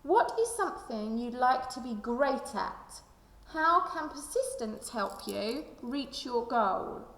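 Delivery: usual speaking voice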